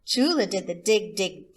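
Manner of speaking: ordinary delivery, nothing marked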